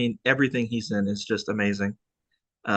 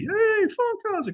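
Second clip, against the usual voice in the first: much higher, slow drawn-out hail; calling out to Funkhauser; slower than usual. Retold or imitated. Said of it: "'cause he was complaining".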